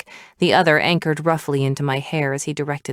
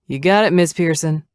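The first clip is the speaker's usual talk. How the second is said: deferential doorman; 4 dB louder than usual. A narrator's reading. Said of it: "came our doorman's deferential tone".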